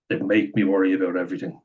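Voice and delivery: ordinary delivery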